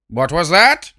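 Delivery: sharp, loud demand; demanding queen. Acted. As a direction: snap the question out loud. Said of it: "demanded the queen".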